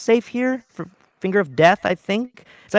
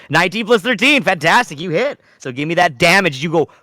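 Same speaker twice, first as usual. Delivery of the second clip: normal talking voice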